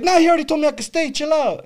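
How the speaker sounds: high, whiny-indignant arguing; retold protest. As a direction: act out arguing your case to stay. Retelling arguing with the cop.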